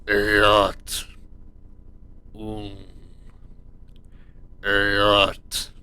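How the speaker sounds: low, possessed demon chant; creepy demon voice. Acted. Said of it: "in a creepy, possessed demon voice".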